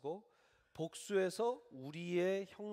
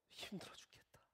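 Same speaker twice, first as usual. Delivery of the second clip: breathy whisper of exhaustion; whispered sigh; 12 dB quieter than usual. Pretend. New employee groaning about the job, whispered. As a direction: whisper it like you're spent.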